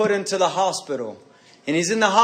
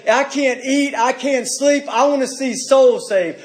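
higher, urgent cry of longing; desperate plea; 5 semitones higher than usual. Acted. A believer cries out to God for souls, urgent. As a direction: cry out like you can't go on.

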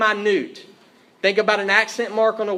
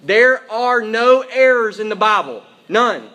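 emphatic declaration, loud and raised; 3 semitones higher, 7 dB louder than usual. Real, not acted. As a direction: proclaim it loudly, stressing each word. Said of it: "We say emphatically, loudly".

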